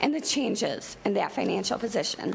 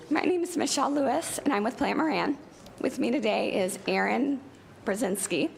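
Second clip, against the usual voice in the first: usual speaking voice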